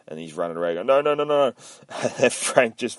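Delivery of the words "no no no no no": higher, quick run of pleading noes; mock panic. Retold soldier flees from Frank, mock panic. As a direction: babble no while running away, lightly hammed-up. Acting a fleeing man's panic.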